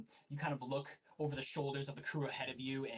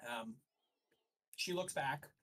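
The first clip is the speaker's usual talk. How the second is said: ordinary delivery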